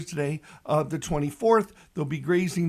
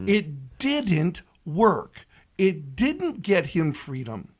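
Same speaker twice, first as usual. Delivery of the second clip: slow emphasis; much slower than usual. Real, not acted.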